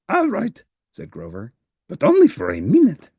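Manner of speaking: high, eager furry monster voice; a cheerful little monster who wants to help; Grover voice. Acted. Grover agrees reluctantly.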